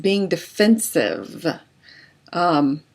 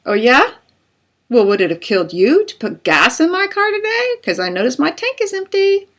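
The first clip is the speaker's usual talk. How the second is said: higher, pointed sarcastic comeback; sarcastic counterattack; 9 semitones higher, 3 dB louder than usual. Acted. Spouse complains about dishes, sarcastic higher counterattack. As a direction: throw the jab right back. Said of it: "or to counterattack".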